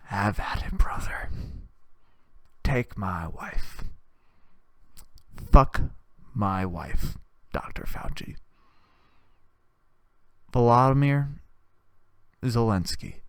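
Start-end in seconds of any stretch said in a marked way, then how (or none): 0.1-8.4 mock hushed patriot. Mock quote of a patriot speaking.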